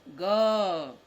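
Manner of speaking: calls the letter out loud for the class. Shown as a demonstration. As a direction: ring the letter out so the kids repeat it. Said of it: "तो यहाँ लिखेंगे".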